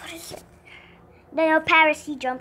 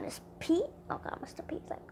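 hushed unvoiced whisper; scared whisper; 15 dB quieter, faster than usual. Real, not acted. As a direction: whisper it so Pete won't hear. Whispering at game monster.

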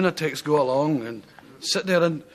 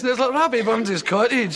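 ordinary talk

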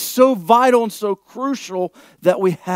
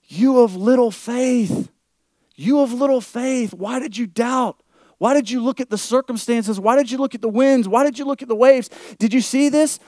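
Jesus rebuking; 6 semitones higher than usual. Acted. Preacher voices Jesus rebuking Peter.

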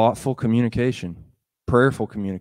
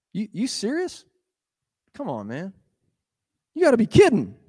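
scoffing disbelief; 8 semitones higher than usual. Acted. Nathanael scoffs at Nazareth.

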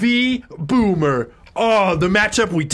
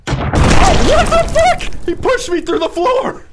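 high, loud burst of disbelief; real outraged shout; outraged yell; 6 dB louder than usual. Real, not acted. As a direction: yell in shock at a game glitch. Outraged yell at game glitch.

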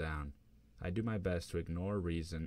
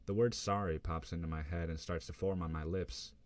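ordinary delivery

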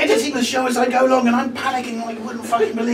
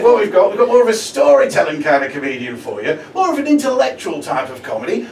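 normal talking voice

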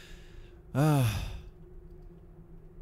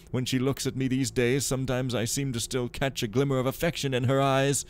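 louder, hammed-up anguished husband reading his diary; dramatic diary reading; 3 semitones higher, 5 dB louder than usual. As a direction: read it like a tormented nobleman's confession. Reads the in-game diary aloud.

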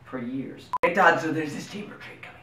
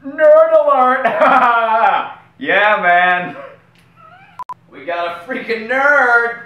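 high, loud alarm yell; nerd alert shout; 10 semitones higher, 9 dB louder, much slower than usual. Acted. A nerd hunter spotting a nerd, loud and high. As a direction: bellow it like a siren going off. Miner hunting nerds.

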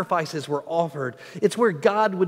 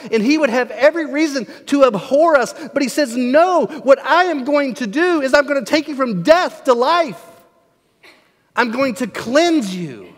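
higher, louder preacher's proclamation; full-force preaching; 8 semitones higher, 7 dB louder than usual. Real, not acted. Preacher declaring God's promise to the sinful, impassioned preaching. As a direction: drive it home to the congregation.

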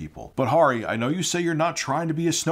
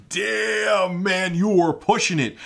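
acted-out shock; 7 semitones higher, slower than usual. Acting out his reaction to Family Guy.

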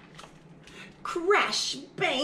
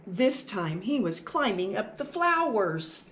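ordinary delivery

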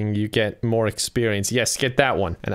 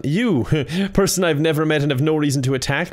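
ordinary talk

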